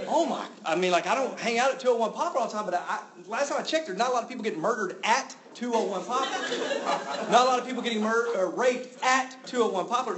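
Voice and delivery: high, loud, laughing through the words; laughing disbelief. Real, not acted. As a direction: laugh at how absurd it is.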